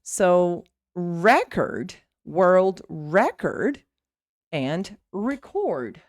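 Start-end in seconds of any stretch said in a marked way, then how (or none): 0.9-3.8 "record" as a noun, REC long and strong; stress on first syllable. Shown as a demonstration. English teacher demonstrating the noun, stressed first syllable of "record". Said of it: "when it's a noun, the stress is here". 5.1-6.0 "record" as a verb, slow re-CORD; stress on second syllable. Shown as a demonstration. English teacher demonstrating the verb, stressed second syllable of "record". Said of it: "When it's the verb, the stress is here".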